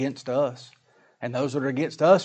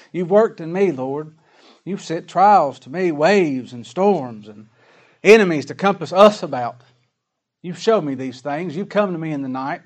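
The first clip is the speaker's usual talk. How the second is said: fervent prayer; 3 semitones higher, 7 dB louder than usual. Acted. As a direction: pray it out forcefully to the Lord. Prays aloud to the Lord.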